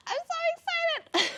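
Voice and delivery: very high, squealed words; real overjoyed shriek; screaming with excitement. Real, not acted. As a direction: squeal it at the top of your range. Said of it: "Sorry for all the screaming".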